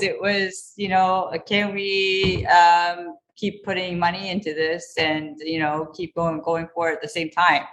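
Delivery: ordinary talk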